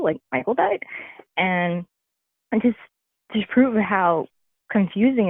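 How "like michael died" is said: retold disbelief, pitch climbing high at the end; incredulous question. Daughter voicing her father hearing of the death, incredulous. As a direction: ask it rising, unable to believe it.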